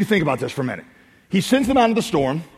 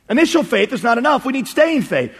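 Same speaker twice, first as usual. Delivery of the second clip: raised pitch, insistent restatement; emphatic repeat; 6 semitones higher than usual. Real, not acted. Preacher repeats the lesson to congregation, high and insistent. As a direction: drive the point home on a higher pitch.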